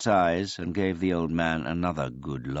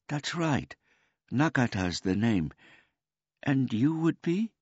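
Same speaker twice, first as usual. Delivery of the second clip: put-on high, polite old man voice; a gentle, childlike old man; simple old man; 10 semitones higher than usual. A narrator's reading.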